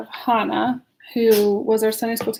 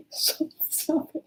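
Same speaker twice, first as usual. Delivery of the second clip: laughing; 4 semitones higher, 7 dB quieter than usual. Real, not acted. Laughing at husband during reading.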